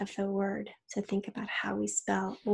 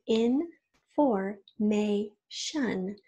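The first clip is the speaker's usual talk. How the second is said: Information stretched very slowly, each of its four syllables pulled apart; teacher slowly sounding out Information one syllable at a time; much slower than usual. Shown as a demonstration. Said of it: "I'm gonna show you".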